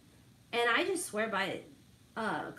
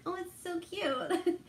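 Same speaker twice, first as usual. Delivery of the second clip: cooing at puppy; 7 semitones higher, slower than usual. Retold or imitated.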